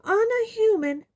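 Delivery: high, shrill-pitched officious woman; high-voiced official. A narrator's reading. Umbridge confronting the centaurs, high imperious voice.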